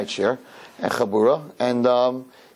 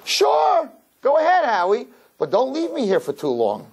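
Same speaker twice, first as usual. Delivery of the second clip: imitated girlfriend, pitched high then easing down; breezy mock-girlfriend consent, starting high; girlfriend's voice; 12 semitones higher than usual.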